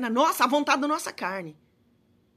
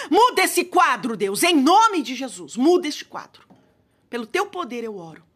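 fervent prayer; 4 dB louder than usual. Acted. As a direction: call on God with force. (oração fervorosa, mais alta)

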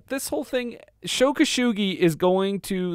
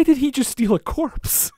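baffled disbelief; 8 semitones higher than usual. Retold or imitated. Recalling first reaction to the film, baffled disbelief. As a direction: replay your bewildered reaction.

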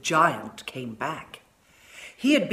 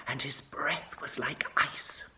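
icy whisper; 3 semitones lower, 5 dB quieter than usual. A narrator's reading. Whispered eerie narration.

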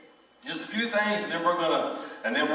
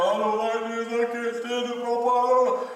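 a light hint of a whining grandchild, a bit higher; whiny grandchild; 4 semitones higher than usual. Grandchild complaining to Papa, lightly whiny voice. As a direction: pout through it like a grumpy kid. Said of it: "They go".

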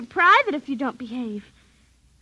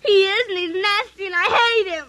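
tearful anger; 3 semitones higher than usual. Acted. Girl tearfully furious at her puppy.

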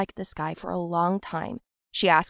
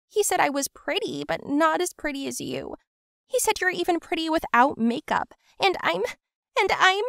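tearful; 8 semitones higher than usual. Acted. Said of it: "and she cried bitterly".

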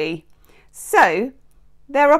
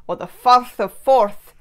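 hammed-up bad Scottish accent on "Firth of Forth"; a comically bad Scottish accent, rolling "Forth"; 5 dB louder than usual. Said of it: "if you want a really bad Scottish accent".

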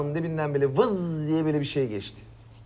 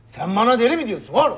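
angry outburst; 7 semitones higher than usual. Acted. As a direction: demand it like an insulted man. Patient gets angry, repeats question. (öfkeyle, daha yüksek ve sert)

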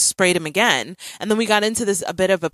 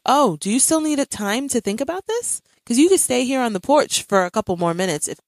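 retold parent talk; 7 semitones higher than usual. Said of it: "this is exactly how we were talking".

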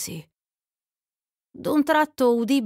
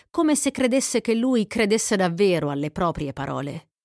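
usual speaking voice

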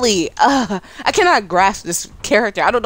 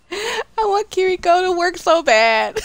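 high, drawn-out whine of wanting; pleading frustration; 9 semitones higher, slower than usual. Real, not acted. Player laments a character they can't master, pleading high and slow. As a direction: whine it with real longing.

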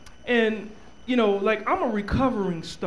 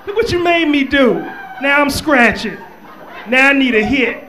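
mock addict; 4 semitones higher, 6 dB louder than usual. Playing the addict.

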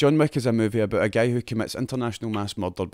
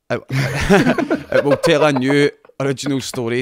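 laughing while reading; 3 semitones higher, 8 dB louder, slower than usual. Real, not acted. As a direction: laugh through a slow, louder read.

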